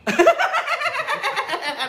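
very high giggling laugh; long laugh. Real, not acted. Seller tickled by nail worker's answer, long high laugh. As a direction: crack up in a high squeal of laughter. Caller says she loves her trade.

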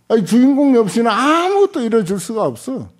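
ordinary talk

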